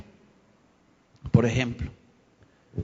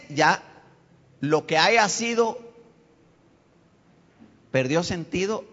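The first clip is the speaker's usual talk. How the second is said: ordinary talk, nothing marked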